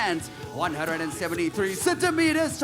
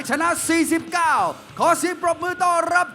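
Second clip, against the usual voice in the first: louder and higher, drawn-out fighter name; booming ring announcer; 10 semitones higher, 7 dB louder, slower than usual. Acted. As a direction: build the crowd up to the name.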